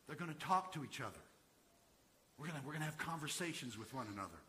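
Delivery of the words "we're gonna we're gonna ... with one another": ordinary delivery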